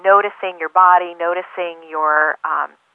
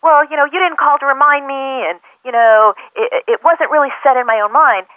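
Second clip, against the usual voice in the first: high, louder defensive excuse-making; a mock example of someone making defensive excuses to a friend; 7 semitones higher, 5 dB louder than usual. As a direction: push the blame back, hammed-up defensiveness. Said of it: "overreact and be defensive".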